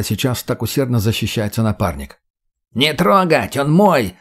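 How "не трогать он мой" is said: strained, higher-pitched cry; strangled shout. A narrator's reading. Грог claims his opponent mid-fight, strangled shout. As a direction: shout it through a squeezed throat. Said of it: "сдавленно закричал тот".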